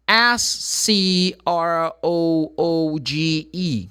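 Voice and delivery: letter by letter, slowly and deliberately; slow, clearly separated letters; slow spelling. Shown as a demonstration. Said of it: "spell the word again slowly".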